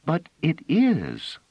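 a hint of a stubborn little girl, slow; lightly voiced child, slow and firm. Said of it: "who said in a lower voice".

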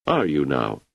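usual speaking voice

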